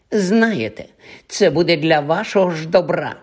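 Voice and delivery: вищим, повчальним голоском святенника; удавай, що дбаєш про них; удавана святенність. Acted. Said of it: "прикидався святенником Півз". (лицемірно, по-святенницьки)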